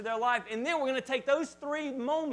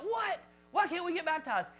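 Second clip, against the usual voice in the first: put-on kids' indignant what; 7 semitones higher than usual. Kids protesting.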